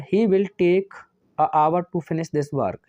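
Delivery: the sentence "a hour" read out slowly as given; slow dictation. Shown as a demonstration. Teacher reading out the question sentence, slow and deliberate. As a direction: read out the faulty sentence word by word. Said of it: "इसे एच silent रहती है".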